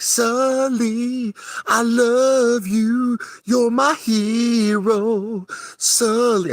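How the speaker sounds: high, slow falsetto swooning over Sully; mock Maria serenade. Imitating Maria singing her heart out to Sully, high and slow. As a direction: croon it like a lovestruck fan, way up high. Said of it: "But she was just like".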